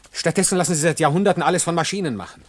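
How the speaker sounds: laut und hoch, die Empörung bricht heraus; empörter Ausbruch. Acted. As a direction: schleudert seine Verachtung für die Gesellschaft hinaus. Hans redet sich in Rage. (Hans wettert zu Irma über die Gesellschaft, laut und empört)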